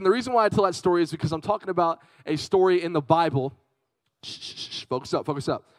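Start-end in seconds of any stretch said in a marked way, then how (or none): 4.2-4.8 soft, unvoiced shushes in a row; repeated quiet shh; shushing. Real, not acted. Hushing the young audience.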